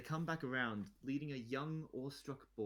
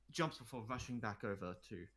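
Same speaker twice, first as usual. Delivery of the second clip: usual speaking voice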